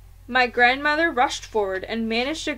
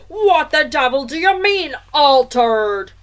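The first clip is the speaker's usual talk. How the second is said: loud, blustering father's demand; angry shout; 4 semitones higher, 11 dB louder than usual. A narrator's reading. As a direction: bellow the question in outrage. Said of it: "shouted Mister Jenkins".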